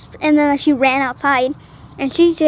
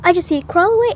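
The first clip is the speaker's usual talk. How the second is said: ordinary talk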